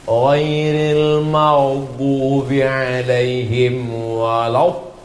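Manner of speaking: slow recitation with a heavy dhad in "maghdubi"; slowly recite, pressing the dhad sound; dhad letter demo. Shown as a demonstration. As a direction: stretch "waladdallin" on the dhad. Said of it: "lughatul dhad".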